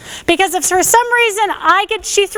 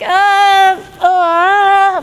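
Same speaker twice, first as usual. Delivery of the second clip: hesitant stammer. Retold or imitated. Instructor mimicking a hesitant victim, stammering. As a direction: stall like a frozen victim. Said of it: "and I'm hesitant".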